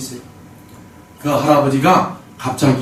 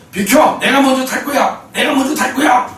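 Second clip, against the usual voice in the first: put-on higher, pushy shouting old man; old man shoving past passengers, higher mock yell; 9 semitones higher than usual. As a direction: shove your way in with the voice. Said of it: "비키라는 거예요".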